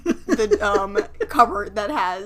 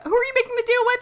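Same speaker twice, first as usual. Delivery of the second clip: high mock female voice; voicing Jem; 5 dB louder, much faster than usual. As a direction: squeak it out as a cartoon heroine.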